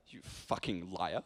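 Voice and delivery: low, slow, quiet mock menace; imitating a hushed, deadpan insult; muttered accusation. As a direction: mutter it darkly as an impression. Acts Ian's muttered accusation.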